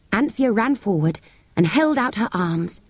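usual speaking voice

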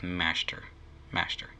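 low "Master" said as "Mashter"; "Master" with the "Mash" leaned on; overdone "mash". Shown as a demonstration. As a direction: repeat "Master" with sh for the s. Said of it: "I'm putting too much emphasis on the mash".

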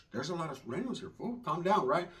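quick, soft hush; urgent hushed calm-down; hushed warning. Retold or imitated. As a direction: shush him down fast. Hushing his cousin.

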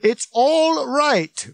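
very slow, high shouted reassurance; loud, drawn-out yell; bellowing. A narrator's reading. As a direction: stretch it out across a crowd. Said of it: "Ford continued to bellow".

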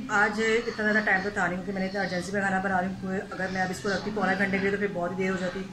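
ordinary talk, nothing marked

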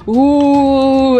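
normal talking voice

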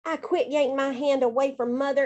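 plain reading voice

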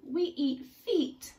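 high, slow, weighty declaration; deliberately menacing; slow gruff piranha. Acted. Piranhas declare their food.

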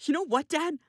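heated, rising to a high outburst; angry disowning. Acted. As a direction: turn on your father in anger. Son angrily disowns his father.

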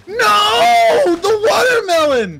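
loud, high, hammed-up cry; anguished wail. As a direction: wail over a tragic loss. Watermelon lost, mock grief.

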